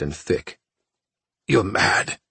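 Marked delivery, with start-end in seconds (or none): 1.5-2.2 choked-out words; choking. Acted. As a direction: force it out through choking. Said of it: "he choked out".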